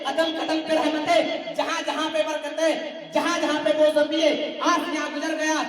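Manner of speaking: sustained chant-like sermon declamation; rhythmic preaching. Real, not acted. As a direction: build a rhythmic litany of praise, line after line. (लयबद्ध जोशीली तक़रीर)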